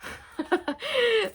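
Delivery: high-pitched amused giggle; high laugh. Real, not acted. Laughing at own bad accent, high giggle.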